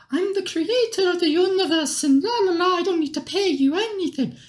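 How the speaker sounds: very high mocking falsetto; mock goddess voice. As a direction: imitate a haughty goddess way up high. Dragon lord mocks the goddess.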